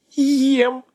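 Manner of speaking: high cackle, then a menacing word; cackling witch. A narrator's reading. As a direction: laugh wickedly, then threaten. Baba Yaga: eats the curious.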